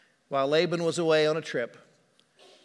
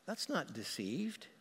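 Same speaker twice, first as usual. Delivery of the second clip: much softer, slow, rising at the end; puzzled thought; 12 dB quieter, slower than usual. Retold or imitated. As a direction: think it aloud quietly, drawn out. Acts out his puzzled thought.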